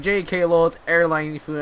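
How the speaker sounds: mock joking announcement. Acted crew announces a joke.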